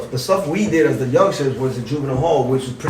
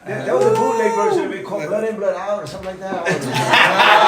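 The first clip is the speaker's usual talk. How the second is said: high, laughing, half sung; 6 semitones higher than usual. Real, not acted. Guest joking about the bootleg film, laughing half sung. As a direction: sing out the title laughing.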